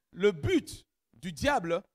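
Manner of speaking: usual speaking voice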